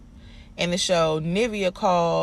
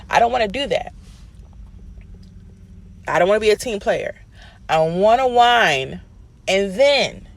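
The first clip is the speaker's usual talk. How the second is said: mocking whiny diva; 3 semitones higher, 4 dB louder than usual. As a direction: ham up a spoiled diva refusing to play along. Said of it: "the vibe I got from that was".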